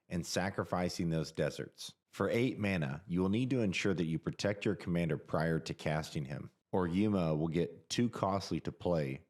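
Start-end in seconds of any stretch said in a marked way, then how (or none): none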